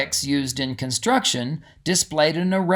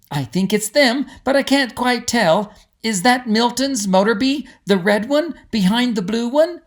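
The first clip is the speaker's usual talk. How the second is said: put-on higher, excited girl voice; eager young Molly; 8 semitones higher, 3 dB louder than usual. Molly asking her mother, excited and eager. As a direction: bubble over with hope.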